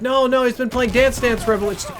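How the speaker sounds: high, loud protest in a retold argument; frantic denial. Friend covers for drunk boy to his mother, frantic denial.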